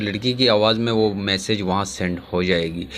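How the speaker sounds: ordinary talk, nothing marked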